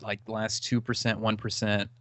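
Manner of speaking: normal talking voice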